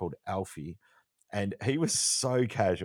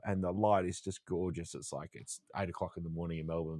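ordinary talk, nothing marked

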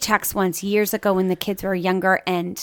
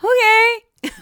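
high, loud meek okay; tearful little okay; 13 semitones higher, 4 dB louder than usual. Retold or imitated. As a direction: answer like a weepy obedient child. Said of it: "I'm like".

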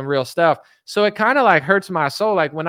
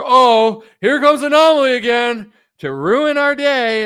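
louder, higher groaning complaint; mock annoyed fans; 7 semitones higher, 7 dB louder, slower than usual. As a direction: whine like annoyed followers. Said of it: "everyone's like".

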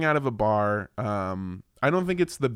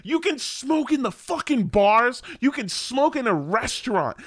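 high, loud outraged rant; exasperated shouting; 13 semitones higher, 4 dB louder than usual. Real, not acted. Traveller ranting that the French smoke everywhere, exasperated shout. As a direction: shout it in high-pitched indignation.

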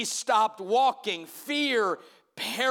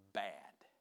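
whispered; 14 semitones lower, 15 dB quieter than usual. Retold or imitated. Scared hunter whispers in retelling.

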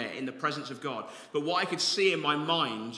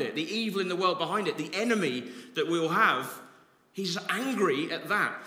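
higher, louder fervent preaching; impassioned preaching; 5 semitones higher, 6 dB louder than usual. Acted. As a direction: drive it home with passion. Preaching about Jesus' anger.

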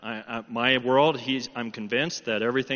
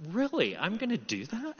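higher-pitched, disbelieving questions; incredulous; 5 semitones higher, 3 dB quieter than usual. Retold or imitated. His reaction to God's instruction.

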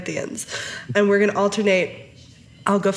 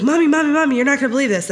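put-on higher-pitched excited little kid; eager child calling for mom; 5 semitones higher, 3 dB louder, much faster than usual. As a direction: imitate a kid bursting with news. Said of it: "He was so excited".

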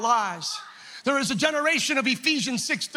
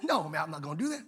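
low, soft, fast refusal; quick, quiet shrug of a no; casual refusal; 9 semitones lower, 8 dB quieter, faster than usual. Acted. Meshach refuses the king.

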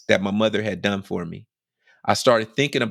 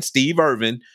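ordinary talk

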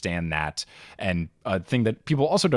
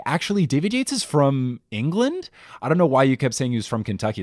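know-it-all correction; 6 semitones higher, 3 dB louder than usual. Retold or imitated. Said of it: "emails from people like".

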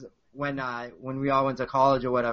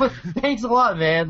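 laughing mock offense, pitched up high; 9 semitones higher, 6 dB louder than usual. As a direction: laugh through a fake-hurt thanks. Retorts to friend's joke, laughing.

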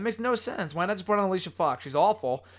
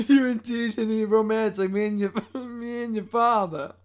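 high, loud mom imitation, amused; high mock mom, slowing at the end; 5 semitones higher, 3 dB louder than usual. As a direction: echo the mom's line, pitched up, breaking into laughter. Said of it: "her voice is brutal".

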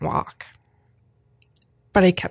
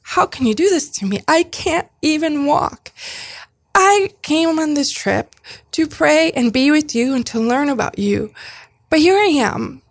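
higher-pitched, pleading mock rant at God; retold outcry at God; 7 semitones higher than usual. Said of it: "just screaming, like, so mad at you".

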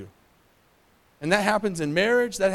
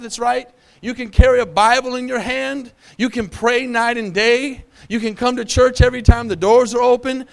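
higher and louder preaching climax; building preaching; 4 semitones higher, 4 dB louder than usual. Acted. Pastor drives the point home to the church, high and loud. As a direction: stack each line on the last, rising.

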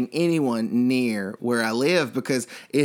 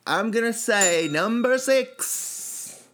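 countdown announcer; 7 semitones higher, much slower than usual. Acted.

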